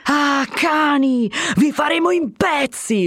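high, slow menacing threat; angry yell. A narrator's reading. Crowd threatening the stone-throwers, yelling. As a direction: snarl it at the bakers. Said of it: "urlava il popolo".

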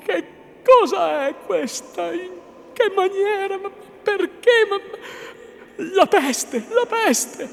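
high, loud, overwrought disbelief; shaken, alarmed astonishment; Lucia, trembling. A narrator's reading. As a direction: stammer out questions in a high female voice. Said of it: "replicò ancor più tremante Lucia".

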